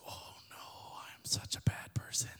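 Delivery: unvoiced, guilty mock whisper; horrified whisper. Heckler learning of the family emergency, aghast whisper.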